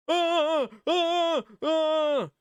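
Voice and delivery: repeated high, loud comic shrieks; mock panicked screams. [name] running around from Chica, mock panicked screams. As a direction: shriek in short bursts, hammed-up panic.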